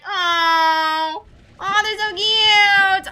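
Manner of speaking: high, slow, squealing over something cute; real gushing with a cry in the voice; overjoyed squeal. Real, not acted. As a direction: melt into a crying coo.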